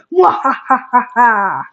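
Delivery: slow villain mwahaha; slow evil cackle. Pretend.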